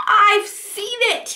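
high, slow excited cry; excited elephant. Acted. Elephant tells everyone he saw it, excited. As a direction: burst out with the news. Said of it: "he said".

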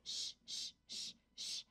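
repeated soft shushes; hushing. Acted. Calming a screaming cat, soft hushing. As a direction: shush softly to settle someone.

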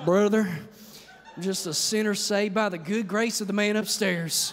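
hammed-up Southern churchgoer; Southern accent. Pastor parodying Mississippi church people, hammed-up Southern accent. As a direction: lay the Southern accent on for laughs. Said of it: "I did the Southern accent".

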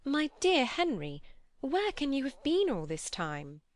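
high, exclaiming sister; impatient cry. A narrator's reading. Sister greeting her late brother Henry, crying out impatiently. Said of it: "met him at last most impatiently".

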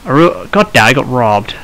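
ordinary delivery

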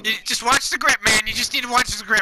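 high, loud panicked outburst; panicked yelling. Real, not acted. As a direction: yelp it in sudden alarm. Panicked after angering the lawman.

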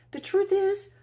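very loud, high shout; cried out. Acted. Camilla stopping the old woman at the door, loud high shout. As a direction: call her back, loud and high. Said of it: "she cried".

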